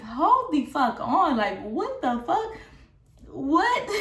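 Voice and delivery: slow, stunned, high-pitched What?; shocked disbelief. Retold or imitated. Retelling to viewers her stunned reaction to the DMs, slow and high. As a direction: drag it out in disbelief, then spike the last word.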